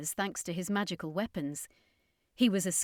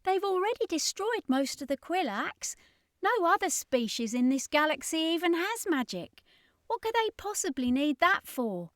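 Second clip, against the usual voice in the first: put-on much higher, baffled girl; Ashley, puzzled; 9 semitones higher than usual. A narrator's reading. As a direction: press the question, high and bewildered. Ashley voiced in story.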